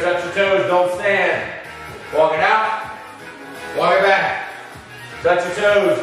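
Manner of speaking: ordinary talk, nothing marked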